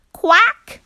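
high, loud duck quack; a squawked duck reply. A narrator's reading. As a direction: quack back sharply like a duck. Duck answers farmer.

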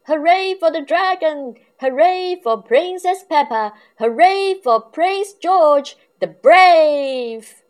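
high, loud crowd cheer. Acted. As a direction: shout it out like a celebrating crowd. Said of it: "Everybody cheered".

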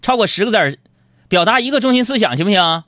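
chirpy, cajoling appeal to listeners; coaxing host. Acted. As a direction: sweet-talk the audience like a radio host.

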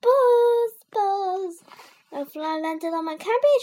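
high, loud panicked shriek. A narrator's reading. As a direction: shriek it out about the fly. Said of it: "shrieked Henry".

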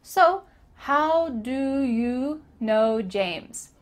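very slow "So, how do you know James" with lazy lips; "how do you" blended, slowed down; slow lazy demo. Shown as a demonstration. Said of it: "not moving your lips very much".

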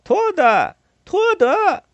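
very high, loud shout across water; full-out high-pitched calling; loud hailing. A narrator's reading. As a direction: yell the name to someone far away. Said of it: "冲着他大声地招呼".

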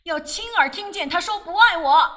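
loud, high anguished shout; high shouted demand. Acted. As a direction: yell it as a final demand. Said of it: "他叫".

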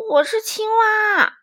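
high, loud, slow drawn answer; sleepy frog voice. Acted. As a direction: answer still half asleep.

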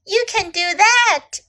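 lightly voiced, high-pitched storybook snake; a hint of an encouraging snake; Snake calling out. A narrator's reading. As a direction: call it out high, as a story character. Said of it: "cried Snake".

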